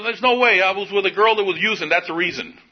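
high-pitched defensive excuse; arguing denial. Retold or imitated. Man giving his parole officer an excuse, in retold defensive arguing. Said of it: "I'm arguing with him".

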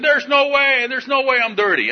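very high-pitched, hammed-up protest; arguing denial. Man denying a dirty test to his parole officer, high indignant arguing. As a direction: deny it in mock outrage. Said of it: "I'm arguing with him".

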